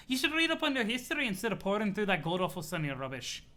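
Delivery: put-on grandiose accented troll, rolling and emphatic. CA threatening a spectacular rivalry, grandiose accented voice. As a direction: threaten with relish in a silly accent. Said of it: "laughing at the C A voice".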